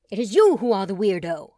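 loud, hammed-up villain intoning; intoned villain. Colin mock-intoning at Truman like a villain, loud. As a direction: intone it like a cape-swirling villain. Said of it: "Colin intoned".

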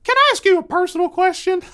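put-on high, loud inquisitive kid; earnest little boy addressing a grown-up; very high child voice; young son's voice. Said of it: "he says".

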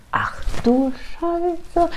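high, very drawn-out oh-dear, kept soft; dismayed reaction. Retold or imitated. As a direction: stretch it out in hushed mock dismay. Therapist reacts to cats detail. (gedehnt, hoch und leise entsetzt)

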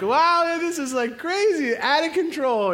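mock-amazed excited cry; thrilled alarm. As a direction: ride the thrill of the chaos. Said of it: "I was like".

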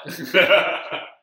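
short, loud burst of laughter; hearty laugh. Real, not acted. Teller joking about watching for the plot, hearty laugh. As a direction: crack up at your own joke.

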